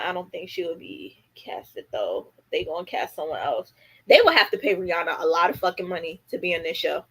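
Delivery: ordinary talk, nothing marked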